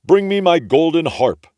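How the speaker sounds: loud high booming giant command; ogre calling. A narrator's reading. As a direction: bellow for the harp. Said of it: "the ogre called out".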